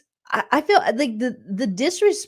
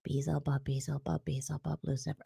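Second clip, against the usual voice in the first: much softer, low murmured chant; hushed incantation; 8 semitones lower, 13 dB quieter than usual. Retold or imitated. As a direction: murmur the demon names like a spell. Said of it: "was like".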